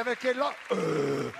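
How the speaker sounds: ordinary talk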